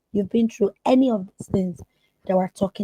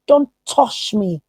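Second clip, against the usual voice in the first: sharp, loud, high yell of get off; a sudden hammed-up shout; angry shout; 7 semitones higher, 9 dB louder, slower than usual. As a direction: snap at the stranger to back off. Retold victim snapping at stranger.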